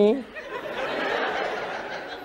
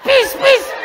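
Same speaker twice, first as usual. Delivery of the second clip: very high mouth-made squirts; high, short squirting noises; water-gun squirt; 11 semitones higher than usual. Acted. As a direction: imitate two shots of a water pistol.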